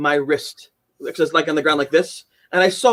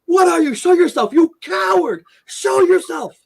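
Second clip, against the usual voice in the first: shouted high and loud at the unseen attacker; retold scream; 12 semitones higher, 4 dB louder, slower than usual. Man in the shower screaming at an invisible ghost, loud high yell. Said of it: "And I screamed".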